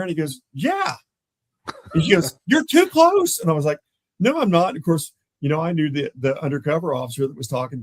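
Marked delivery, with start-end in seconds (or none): 0.5-1.0 very high, rising yeah; exasperated confirmation. Retold or imitated. Partner confirms the suspect's description, exasperated. Jordan reacts to matching description. 2.4-3.4 very high, loud mock scolding; exasperated yell. Partner scolds officer for being near the sting, exasperated yell. 4.2-4.7 high, loud retold protest; mock arguing straight back; defensive denial. Officer argues back at scolding partner, defensive denial.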